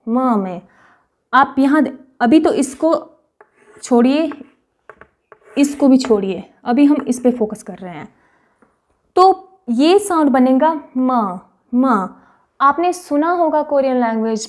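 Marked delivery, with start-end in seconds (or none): none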